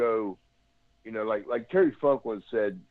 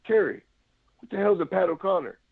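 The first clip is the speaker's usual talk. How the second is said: baffled call-out; 7 semitones higher than usual. Retold or imitated. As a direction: shout across the ring, mock confused. Wrestler calls across the ring.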